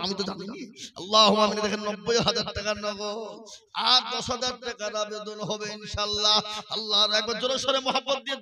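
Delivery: ordinary delivery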